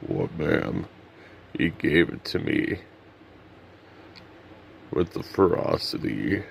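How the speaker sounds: low, husky-free deep narrator voice. Pretend. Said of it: "I'm doing a [name] impression".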